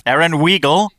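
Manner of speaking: Weigel said as WEE-gul, loud and high; teasing mispronunciation. Shown as a demonstration. Guest teases host about pronouncing Erin's name, Weigel wrongly said WEE-gul. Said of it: "no, it's Erin Weigel".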